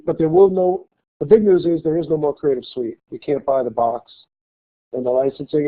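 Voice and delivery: ordinary talk, nothing marked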